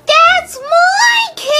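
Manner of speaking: high, slow wailing shout; anguished shout. Acted. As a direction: wail it out over the ruined cake. Cake destroyed.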